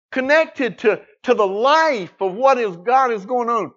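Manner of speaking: high, loud preacher's shout; impassioned pulpit shout, pitch soaring; fervent preaching. Real, not acted. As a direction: cry it out to the whole congregation. Preacher at full force.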